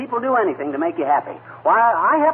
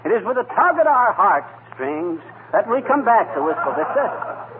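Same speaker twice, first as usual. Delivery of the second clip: slow, mock-solemn oration; rehearsed speech; 4 semitones higher than usual. Husband rehearsing his homecoming speech, slow mock oration. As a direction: practise the grand speech, slowly. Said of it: "let me see again".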